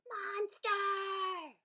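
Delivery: high, loud cheer. A narrator's reading. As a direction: cheer together gleefully. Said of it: "they all cheered together".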